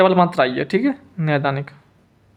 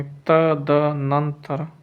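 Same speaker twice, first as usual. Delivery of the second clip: तदनंतर broken slowly into त-द-नं-तर; slow, separated syllables in तदनंतर; slow dictation; much slower than usual. Shown as a demonstration. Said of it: "तदनंतर ऐसे लिखेंगे".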